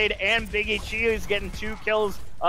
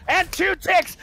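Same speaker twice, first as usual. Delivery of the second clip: excited call; 9 semitones higher, 3 dB louder than usual. Real, not acted. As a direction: draw out each tick. Counting down the objective.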